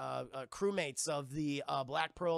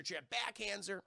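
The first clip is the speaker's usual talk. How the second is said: ordinary talk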